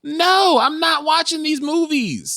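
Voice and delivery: high-pitched, indignant no; emphatic refusal. Real, not acted. Friend refusing to watch scary movies, high-pitched emphatic protest. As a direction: refuse outright, pitch shooting way up.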